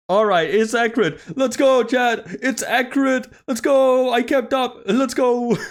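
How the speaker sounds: high, loud victory cheer; excited cheering. Real, not acted. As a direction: celebrate like you just nailed it. Cheering a correct call on stream.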